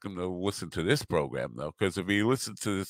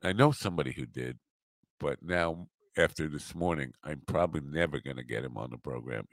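ordinary talk

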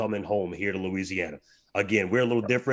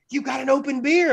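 aggressive accusation; 14 semitones higher, 4 dB louder than usual. Retold or imitated. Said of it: "she come at me kind of aggressive".

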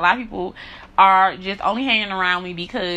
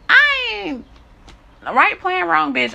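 high and loud, defiant burst; sudden loud outburst; 10 semitones higher, 4 dB louder, slower than usual. Real, not acted. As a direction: throw it out loud and high, daring anyone. Emphatic outburst in rant.

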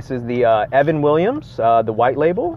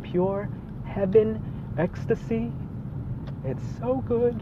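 blissful savoring; 9 semitones higher, 4 dB quieter, much slower than usual. Real, not acted. Savoring the first sip.

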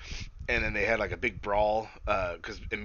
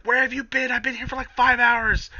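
mock Britt; 14 semitones higher, 8 dB louder than usual. Britt voiced, complaining from dumpster.

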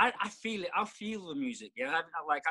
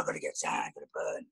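breathy whispered aside, much lower and softer; 6 dB quieter than usual. Retold or imitated. Retelling his old urge to tan, whispered. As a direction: hush it like a secret urge.

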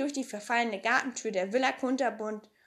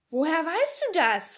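doubting Tommy; 6 semitones higher, 5 dB louder than usual. Acted. Tommy questions Pippi.

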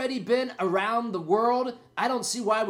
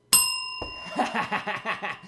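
1 laughs at own joke. Real, not acted.

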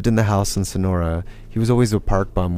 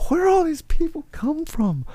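much higher, stunned question; incredulous. Retold or imitated. Boarding the packed bus, much higher incredulous question. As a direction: marvel at the crowd.

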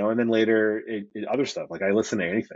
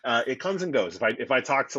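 ordinary talk

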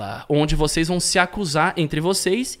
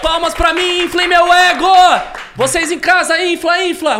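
high, loud self-hyping shout; hype shout; 11 semitones higher, 7 dB louder than usual. Acted. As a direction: pump up the audience. (gritando empolgado)

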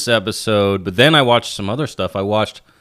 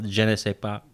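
put-on casual French-accented aside; 4 dB quieter than usual. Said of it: "as the French say".

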